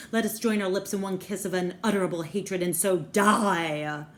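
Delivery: hammed-up furious yelling; mock angry yell. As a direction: hurl it as a theatrical accusation. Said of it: "he yells at her all of a sudden".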